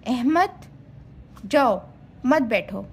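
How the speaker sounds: long pause after "Ahmad", short one after "जाओ"; "Ahmad" rising, stop, "जाओ", then "मत बैठो"; comma pauses. Shown as a demonstration. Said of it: "जहाँ ज़रूरत हो वहाँ सक्ता का निशान".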